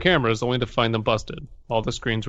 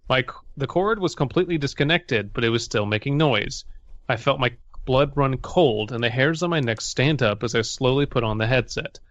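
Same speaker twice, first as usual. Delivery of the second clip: ordinary talk, nothing marked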